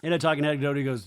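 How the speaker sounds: ordinary delivery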